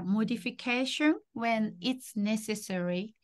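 slow, measured phrase for repeating; unhurried teacher's model; slow modelling. Shown as a demonstration. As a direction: say it slowly for a learner to copy. Teacher models whole phrase for learner.

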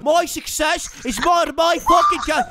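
menacing Irish fighter's challenge; Conor McGregor. Acted. Challenging the players to a fight, menacing Irish accent. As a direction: threaten them in an Irish brogue.

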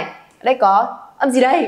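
usual speaking voice

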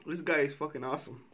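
ordinary talk

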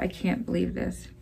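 half-voiced under-breath dismay; real quiet disbelief; whispered disbelief. Real, not acted. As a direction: mutter it to yourself. Muttering over the mistake.

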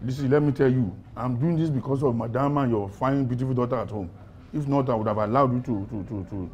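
low, hushed deal-making; confidential offer. Acted. Officer offering prisoner release on one condition, hushed and low. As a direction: lay out the terms quietly and deliberately.

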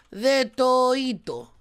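slow stretched name reveal; drawn out. Pretend. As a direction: draw the name out slowly. (nombre alargado, lento)

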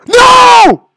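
high, loud scream of NO; frustrated scream. Real, not acted. Gamer failing the jump, frustrated scream. Fails in rage game.

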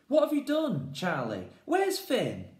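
high, loud alarmed cry; alarmed shout. Acted. Jazz shouting at Charlie in alarm, loud and high.

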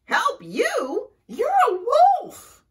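high, loud, incredulous cry. A narrator's reading. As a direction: shriek back at the wolf in disbelief. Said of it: "cried Skunk".

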